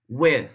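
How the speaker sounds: "with" ending on th; no extra vowel. Shown as a demonstration. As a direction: let "with" end on the consonant. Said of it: "Você vai falar". ("with" sem vogal no final)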